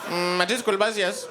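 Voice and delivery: imitated Indian kid's accent; lightly put-on Indian accent, hesitant opening hum. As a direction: kid caller with an Indian accent. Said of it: "there'd be the one Indian kid".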